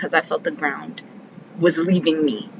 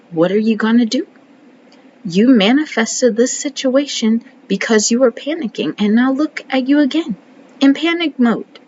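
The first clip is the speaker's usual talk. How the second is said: higher and louder reproach building up; scolding herself; 4 semitones higher, 5 dB louder than usual. Real, not acted. Scolding herself for panicking again, higher and louder. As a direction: call yourself out, rising in pitch.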